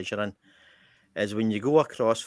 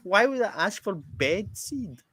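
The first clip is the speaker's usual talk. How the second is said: incredulous; 12 semitones higher than usual. Retold or imitated. Customer baffled by bed seed, high incredulous questioning. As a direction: puzzle it out in a high squeak.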